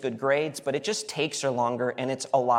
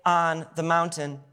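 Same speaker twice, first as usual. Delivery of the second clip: hopeful Peter; 8 semitones higher than usual. Retold or imitated. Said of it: "he's like".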